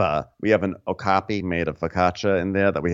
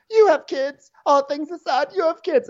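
mock sobbing.

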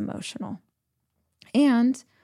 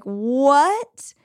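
mock disbelief; 6 semitones higher, 3 dB louder than usual. Reacting to mom suggestion.

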